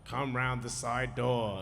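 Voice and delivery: slow voice as if over a tannoy; drawn-out, lightly imitated intercom announcement. Film director to arriving visitors over a tannoy, mock intercom voice. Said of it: "out of this tannoy came this".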